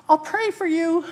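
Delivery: high, loud call from shore. Acted. Bystander calling to woman flying by, high and loud. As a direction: sing it out to someone passing. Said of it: "the person said".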